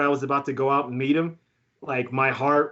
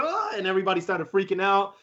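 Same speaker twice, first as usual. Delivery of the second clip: normal talking voice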